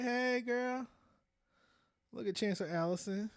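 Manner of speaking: usual speaking voice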